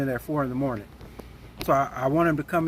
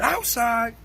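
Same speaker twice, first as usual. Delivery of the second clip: mock challenge; 7 semitones higher than usual. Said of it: "he said".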